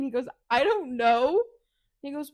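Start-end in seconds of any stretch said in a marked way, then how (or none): 0.5-1.4 loud mock exasperation; exasperated retort. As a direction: throw it back loudly, acting fed up. Acted retort in quarrel.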